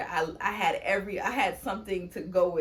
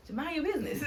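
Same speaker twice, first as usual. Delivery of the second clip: playful brush-off; 9 semitones higher than usual. Retold or imitated. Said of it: "And I was like".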